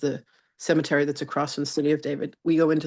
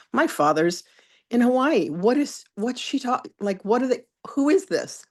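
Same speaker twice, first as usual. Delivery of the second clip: rising higher, flustered and alarmed; panicked confusion; 8 semitones higher than usual. Retold or imitated. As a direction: blurt it out, bewildered and climbing in pitch. Panicked inner thought in trance.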